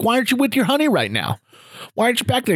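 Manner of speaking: put-on high, quick prying old-timer; Gramps' voice. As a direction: demand an answer like a nosy grandpa. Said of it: "Gramps Grant's talking like this".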